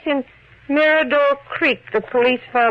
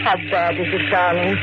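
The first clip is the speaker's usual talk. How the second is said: tender concern; 7 semitones lower than usual. Acted. Wife over injured husband.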